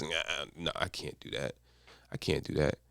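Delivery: hushed, low, defeated mutter of the voice inside; doubting inner voice. Acted. The doubting inner voice talking you out of it, hushed and low. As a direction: give up before even trying, very softly. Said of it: "that voice pops up and says".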